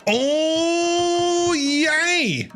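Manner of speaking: normal talking voice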